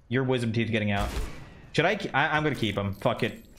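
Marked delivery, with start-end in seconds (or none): none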